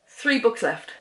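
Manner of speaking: ordinary talk, nothing marked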